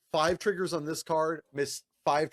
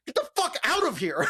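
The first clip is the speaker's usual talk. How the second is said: mock irritated brush-off; 11 semitones higher, 4 dB louder than usual. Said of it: "They're like".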